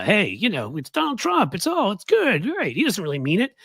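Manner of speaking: high-pitched mock reassurance; mock breezy excusing. Imitating people excusing Donald Trump, high and dismissive. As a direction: wave it away in a put-on high voice.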